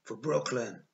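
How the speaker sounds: loud rallying cry; battle yell. A narrator's reading. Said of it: "I heard Amos yell".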